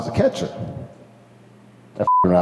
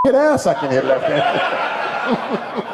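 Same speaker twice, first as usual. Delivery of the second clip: laughing; 11 semitones higher, much slower than usual. Retold or imitated. Laughing boast to the coach.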